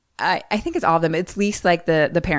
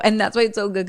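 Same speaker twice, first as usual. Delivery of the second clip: ordinary talk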